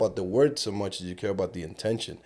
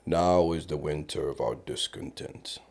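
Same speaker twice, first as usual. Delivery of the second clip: Shakespearean recital; 5 semitones lower, 8 dB quieter than usual. Shown as a demonstration. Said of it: "when you don't know".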